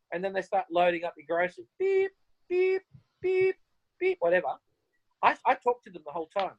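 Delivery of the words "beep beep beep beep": high, squeaky beep-beep of a checkout scanner; mock scanner beeps. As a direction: chirp like a till scanner.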